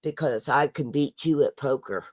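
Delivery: drowsy, slurred and low; groggy from anesthesia. Real, not acted. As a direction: talk like you're barely awake after surgery. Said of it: "that's why I'm talking so funny".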